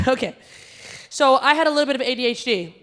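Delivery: ordinary talk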